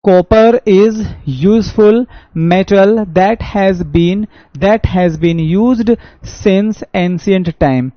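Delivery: stretched-out dictation reading; slow reading aloud. Shown as a demonstration. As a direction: read each word of the sentence for the class. Reads quiz sentence aloud.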